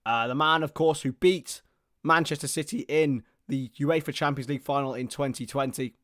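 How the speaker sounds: steady live match commentary; play-by-play. Acted. Commentator calling the match for viewers, play-by-play. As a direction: narrate each pass as it happens.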